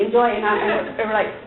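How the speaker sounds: ordinary talk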